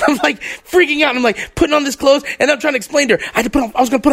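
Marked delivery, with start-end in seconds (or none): none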